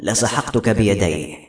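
usual speaking voice

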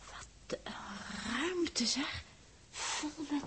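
whisper. Acted. Awed by huge storeroom.

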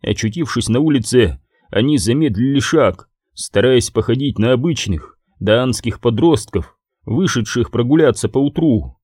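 ordinary delivery

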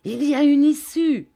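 high, loud joyful shout; cry of joy. A narrator's reading. Sir Hassel crying out with joy on finding an exit. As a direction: shout the discovery. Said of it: "poussa un cri de joie".

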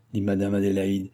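ordinary delivery

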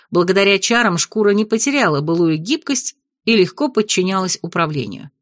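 usual speaking voice

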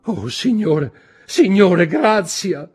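very high, loud cry for mercy; desperate plea. A narrator's reading. Wife begs her judge husband, desperately. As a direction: beg frantically, voice soaring.

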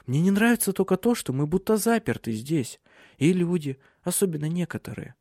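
wife confiding what she loves and dislikes; Silje, fond but uneasy. Acted. Voices Silje in dialogue. (женский голос героини, повыше)

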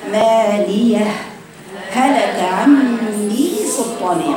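slow Quran recitation, مالية then a short stop before هلك; pause without breath. Shown as a demonstration. Teacher reciting to students with a breathless pause. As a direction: recite مالية هلك very slowly, cutting briefly between the two ه sounds. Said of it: "هاتي الهاء الثانية محط-، بينيها مخرجها".

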